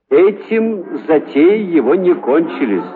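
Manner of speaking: plain reading voice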